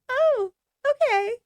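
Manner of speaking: very high, slow, airheaded "Oh, okay"; mock dopey follower. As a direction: squeak it out like a brainless yes-man. Mocking dumb followers agreeing.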